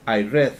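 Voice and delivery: "read" as red, with a short e; past-tense read. Shown as a demonstration. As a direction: stress "read" and say it like the colour red. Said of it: "yo leí ese libro ayer".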